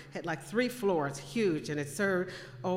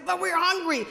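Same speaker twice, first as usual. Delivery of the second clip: put-on high, loud protest of hungry children; retold child's complaint; 12 semitones higher, 7 dB louder than usual. Hungry children pleading with their mother at bedtime, loud complaint. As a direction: complain loudly, pitched well up.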